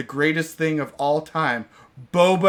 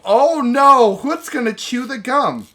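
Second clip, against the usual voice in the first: hammed-up panic, high, loud and slow; mock alarm; 9 semitones higher, 7 dB louder, slower than usual. Narrating the cartoon's crisis with hammed-up, high, slow panic. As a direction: fake cartoon dread over the gum.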